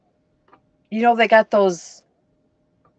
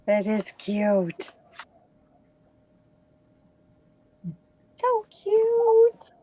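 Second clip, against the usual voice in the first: cooing; 2 semitones higher, 3 dB quieter, much slower than usual. Real, not acted. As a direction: gush over something tiny. Cooing over the bootie.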